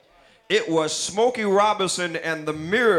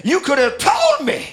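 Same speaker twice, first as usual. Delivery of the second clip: higher and louder wounded accusation, half-laughing; mad; 5 semitones higher, 4 dB louder than usual. Acted. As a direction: hurl it at her like a betrayed lover, voice cracking into a laugh. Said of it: "Then he got mad and said".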